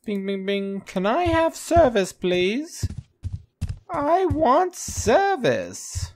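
high falsetto call for service; squeaky-high playful demand, drawn out at the end; high mock calling. Player to an empty counter demanding service, high mock calling.

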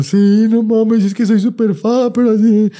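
high, slow whiny fangirl voice; hammed-up desperate superfan; mock whiny fan.

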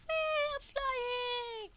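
soft, very high squeal; squeaky flying glee. Acted. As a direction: squeak it like someone sailing away. Voices the character flying off.

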